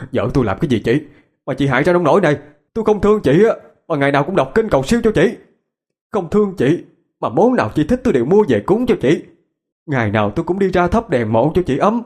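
fast, high-pitched indignant outburst; angry yelling. A narrator's reading. Brother confronts his dead sister over his wife, angry yelling. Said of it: "Vinh tức giận quát".

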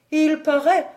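usual speaking voice

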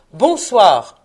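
loud, falling goodnight; curt dismissal. A narrator's reading. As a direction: shut him out with one forceful word. Scrooge congédie encore son neveu. (congédiement sec et fort)

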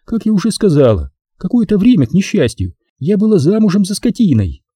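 high-pitched woman, sweetly bitter; Maxine's high, intimate complaint; confiding. A narrator's reading. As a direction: share it confidingly in a high female voice. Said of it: "Её улыбка стала ещё более доверительной".

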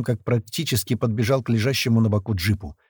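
ordinary delivery, nothing marked

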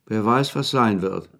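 slow, heavy recital of a gloomy poem; reciting verses. Acted. Wetschorowski recites ominous verses to the anxious Maljanow, slow and solemn. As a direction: intone the lines slowly, like a dark prophecy. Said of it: "Dass es sich um Verse handelte".

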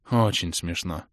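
low, quiet grumble; grumbling. A narrator's reading. The girl grumbles at the hero's joke. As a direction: mutter it sourly under the breath. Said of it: "проворчала девушка".

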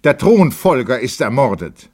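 loud, high cry of alarm; called-out news. A narrator's reading. Guest announces the assassination to dancers, called out. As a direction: call the news out across the room. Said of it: "Jemand rief".

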